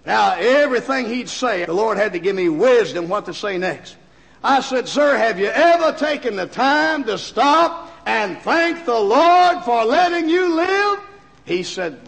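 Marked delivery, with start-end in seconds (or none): 4.9-11.0 rising, slowed, pleading question; earnest plea. Retold or imitated. Preacher pleading with the businessman, slow and rising. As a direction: press him gently but urgently.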